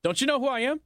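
mocking the mayor's who-do-you-think-I-am tone; mock entitled mayor. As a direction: whine it like an entitled bigshot. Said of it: "so I always run around".

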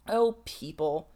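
slow, heavy weary sigh of words. A narrator's reading. Charlotte to her cousin, wearily slow. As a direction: let it drop out tired and slow. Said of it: "said Charlotte Lovell wearily".

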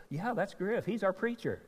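put-on high bright-eyed counter girl voice; little girl. Counter girl telling the mother who the preacher is, high girlish voice. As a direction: pitch it up like a young girl who knows him. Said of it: "a little girl behind the counter said".

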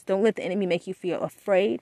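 ordinary talk

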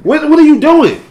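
high, loud, stumbling demand; scared questioning. Retold or imitated. Roommate questioning a sleepwalker with closed eyes, scared. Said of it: "I'm mad 'cause I'm scared".

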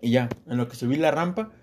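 usual speaking voice

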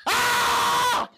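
sudden high shrieking scream at the phone; demo scream. Shown as a demonstration. Screaming at the phone over one tick. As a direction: let out a piercing frustrated scream. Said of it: "I will scream at the phone".

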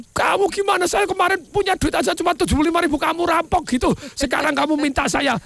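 high-pitched indignant tirade; acted anger. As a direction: victim protesting the robber's price. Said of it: "Marah orangnya". (pura-pura marah, nada tinggi)

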